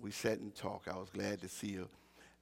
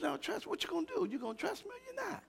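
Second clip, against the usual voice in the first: pressing question; faster than usual. Acted. God's voice quoted, pressing.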